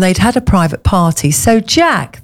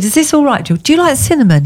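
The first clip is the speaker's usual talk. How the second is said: put-on higher and bright-eyed, a fussy demonstrative young waiter; mock young waiter, pitched up, hand on hip; 8 semitones higher, faster than usual. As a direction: play the eager manager offering a drink. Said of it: "and he said".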